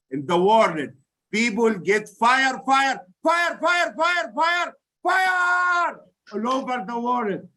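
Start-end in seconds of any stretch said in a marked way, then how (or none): 1.3-7.5 shouting. Real, not acted. Rallying chant to close speech.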